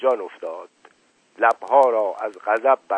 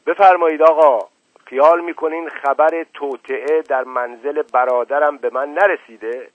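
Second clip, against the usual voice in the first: louder and higher furious shout; 4 semitones higher, 6 dB louder than usual. A narrator's reading. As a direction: order the doctor out, shouting. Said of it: "فریاد زد". (فریاد خشمگین)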